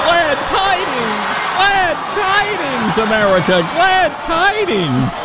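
high, slow hammed-up crier call; imitating town crier. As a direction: drag out the words like a crier. Host mimics the crier.

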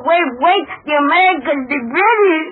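put-on boy echoing papa's anguished cry. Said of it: "My papa cry out".

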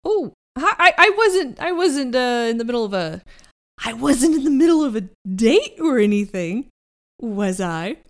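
high flustered stammer; flustered Herman. Acted. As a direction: Herman caught off guard, stumbling.

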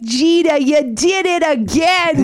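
put-on high, drawn-out Boston accent, gushing; excited Boston sportscaster impression; thick Boston accent. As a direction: gush like an overjoyed Boston broadcaster. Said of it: "she'd have the thick Boston accent".

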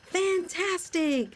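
high, slow, drawn-out cheer; happy exclamation. Acted. Henry celebrating the fixed bridge, slow high cheer. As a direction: stretch the word out in delight. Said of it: "Henry exclaimed happily".

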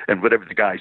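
ordinary talk, nothing marked

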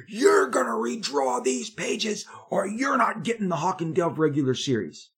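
screamed threat. Retold or imitated. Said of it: "he screamed at me".

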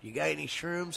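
hushed, sneaky fan asking for shrooms; sly fan question. Pretend. Fan sneaking a drug question to the band, hushed. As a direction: lean in and ask it quietly like a fan with a secret. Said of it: "we'll ask the questions like".

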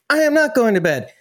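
high, emphatic mock-child refusal; defiant kid. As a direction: dig in like a kid refusing bedtime. Retold kid-versus-dad bedtime standoff.

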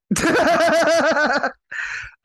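high burst of laughter; loud laugh. Real, not acted. Host laughs at rant.

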